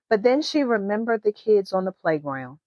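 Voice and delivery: ordinary delivery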